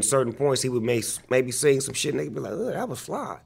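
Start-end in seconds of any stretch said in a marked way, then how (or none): 2.5-3.4 high, admiring ugh; impressed friend. Retold or imitated. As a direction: sound blown away by it. Friends reacting to Nate's singing.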